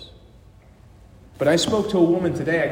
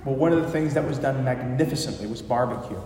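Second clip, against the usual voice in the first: usual speaking voice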